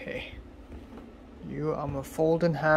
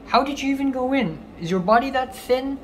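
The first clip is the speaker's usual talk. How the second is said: incredulous; 9 semitones higher than usual. Real, not acted. Incredulous at cockroach.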